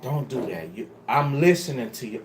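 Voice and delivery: low, soft and gentle, calming him down; calm reverent reply. Shown as a demonstration. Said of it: "Have the reverence ... and say".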